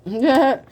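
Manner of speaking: amused burst of laughter; quick chuckle; laughing at herself; short laugh. Real, not acted. Laughs at food joke.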